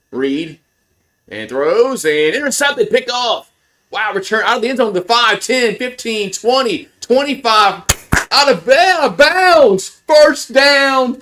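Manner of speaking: high, elated interception call building to a loud cheer; excited play-by-play. Real, not acted. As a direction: erupt like a home-team announcer on a pick-off. Calls an interception live.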